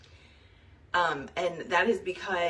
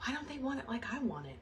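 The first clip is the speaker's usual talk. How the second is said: mock frustrated leader; 5 semitones higher, 8 dB quieter, faster than usual. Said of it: "I'm one of those leaders".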